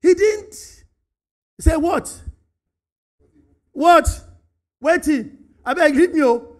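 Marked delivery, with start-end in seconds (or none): 1.8-6.5 loud, high, dismissive snap; rude retort. Retold or imitated. Cain answering God rudely, loud and irritated. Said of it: "how Cain spoke to God".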